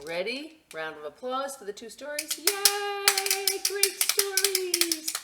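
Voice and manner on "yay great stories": much higher, louder cheer. Real, not acted. Cheering storytime children.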